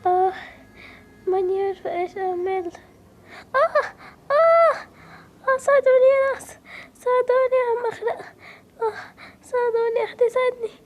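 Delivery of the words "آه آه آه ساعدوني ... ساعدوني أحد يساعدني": panicked scream. Acted. Drowning girl screaming for help.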